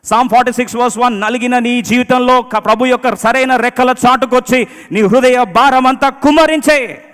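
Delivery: high, slow, very loud proclamation; full-force preaching. Real, not acted. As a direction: declare it to the whole church at the top of the voice.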